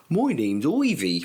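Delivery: put-on slow West Country Bristol accent. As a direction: say it like a little Bristol farmer kid. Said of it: "talk like she was from Bristol".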